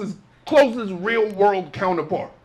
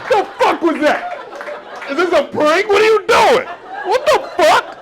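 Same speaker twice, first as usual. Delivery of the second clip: louder, higher stunned outburst; shocked disbelief; 8 semitones higher, 5 dB louder than usual. Retold or imitated. Comedian reacting to a cop's answer, loud shocked disbelief. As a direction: blurt it like you can't believe this city. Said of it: "I was like".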